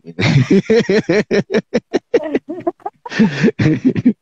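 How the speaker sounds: long, high-pitched giggling laugh. Real, not acted. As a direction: keep giggling at your own joke. Joking that colleague will answer.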